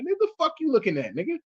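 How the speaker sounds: high, quick confrontational jab; laughing at his younger hotheaded self; mock hostile snap. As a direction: fire it off with teen attitude. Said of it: "Had the nerve to have an attitude".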